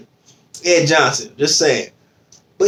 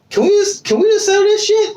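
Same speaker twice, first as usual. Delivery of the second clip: hammed-up crying plea, pitched way up; mock tearful plea. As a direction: voice cracking high, half-crying. Retold plea to estranged friend.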